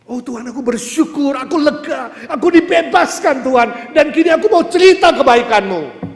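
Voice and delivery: high, loud cry of thanks, building in force; preacher acting out exultant thanksgiving; acted grateful prayer. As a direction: cry out to God like a freed believer. Acts believer's grateful cry to God.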